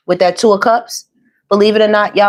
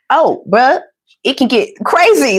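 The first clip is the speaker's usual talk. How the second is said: higher-pitched put-on [name] accent; [name]'s accent; 5 semitones higher than usual. Reader quoting [name] to viewers, playful accent impression. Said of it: "I don't even know where this accent is coming from".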